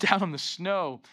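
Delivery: usual speaking voice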